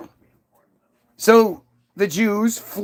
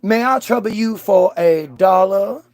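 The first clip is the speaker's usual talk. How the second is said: genteel Southern. Acted. Southerner politely asking a stranger for money, genteel Southern accent. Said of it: "a lot of the South is, was that".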